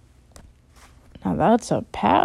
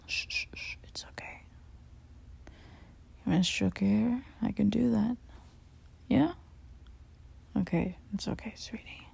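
soft shushing comfort; calm her down gently, very softly; tender whispered reassurance after the scene; soothing hush; 2 semitones higher, 7 dB quieter than usual. Real, not acted.

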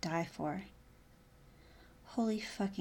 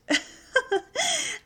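high falling laugh, louder; teasing laugh; 7 dB louder than usual. Acted. Amused at his reaction.

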